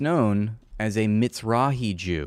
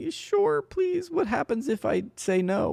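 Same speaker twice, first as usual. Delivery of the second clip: mock reply; faster than usual. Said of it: "He's like".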